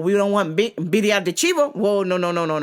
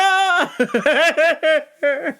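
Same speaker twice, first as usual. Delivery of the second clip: higher, louder laugh rising at the end; real laughter; 7 semitones higher, 4 dB louder than usual. Real, not acted. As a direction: burst out laughing after the punchline. Laughing after "Sanka!.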